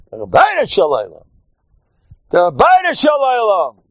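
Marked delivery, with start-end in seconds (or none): 2.3-3.8 high, ringing proclamation; emphatic shout. Real, not acted. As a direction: shout it out as the one true answer. Repeats the answer as a proclamation.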